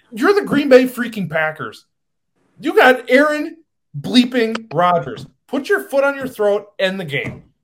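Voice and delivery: worked-up, exasperated sports rant; emotional fan venting at the team; getting emotional. Real, not acted. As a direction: raise pitch and volume in real frustration. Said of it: "now I'm getting emotional".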